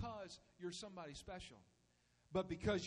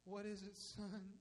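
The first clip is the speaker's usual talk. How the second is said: worn-out father; 4 semitones lower, 9 dB quieter than usual. Acted. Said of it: "that twitch kinda coming in the eye".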